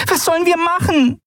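tiny, high, frantic voice; a little winged creature in a panic; anxious fairy voice. Acted. Small fairy Nelen panics.

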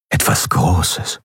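slow, whispered ominous line; whispered menace. A narrator's reading. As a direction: let it hang in a whisper. Tense narration of approaching danger. (langsam geflüstert, bedrohlich)

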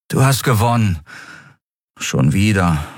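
mildly resigned, sinking at the end; resigned sigh. Acted. Miko admits defeat to Nelen, resigned. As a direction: concede with weary acceptance. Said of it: "seufzte er resigniert".

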